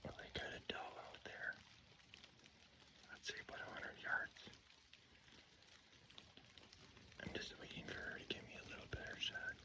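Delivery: very soft, unvoiced-sounding hush; hunter's whisper. Real, not acted. Hunter watching a doe, waiting for a shot, barely whispered. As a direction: whisper so the doe stays put.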